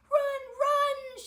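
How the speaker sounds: high, very loud warning; urgent shout. A narrator's reading. Mom sends Victor away from the dragon, urgent shout. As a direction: shout it to send someone fleeing. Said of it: "shouted Mom".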